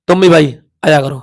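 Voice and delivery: ordinary talk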